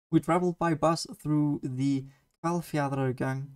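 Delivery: Hvalfjarðargöng said with Icelandic sounds; careful Icelandic pronunciation of Hvalfjarðargöng; Icelandic place name. Shown as a demonstration. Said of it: "I'm gonna try and say these ... in Icelandic".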